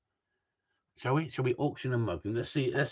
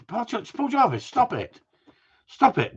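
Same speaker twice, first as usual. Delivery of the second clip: mock scolding; 12 semitones higher than usual. Real, not acted. Teasing a bidder in chat.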